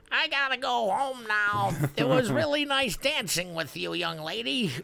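put-on high, loud old gentleman voice; old man voice. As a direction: play the polite old man. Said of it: "And he's like".

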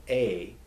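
"A" as the vowel in ace; long A. Shown as a demonstration. Teacher warns against it, long A as in pay. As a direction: say "A" like pay's vowel. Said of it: "don't ever pronounce it as".

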